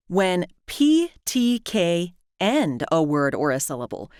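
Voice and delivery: normal talking voice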